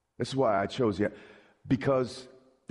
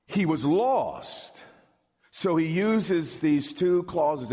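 slow, drawn-out preacher emphasis; slow emphatic; 2 semitones higher, much slower than usual. Real, not acted. Preacher slowing to stress that the son was lost. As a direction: lay each word down slowly. Preacher drawing out key phrase.

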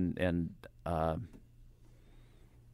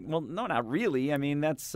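higher-pitched, mild protest; retold arguing; 7 semitones higher than usual. Host re-enacting his argument with the singer, mild protest. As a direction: push back, lightly acted. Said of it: "I started arguing with her".

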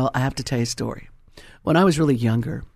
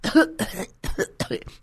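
high, spluttering mock coughs; acted choking cough; 7 semitones higher, 3 dB quieter than usual. Acts out choking on cigarette.

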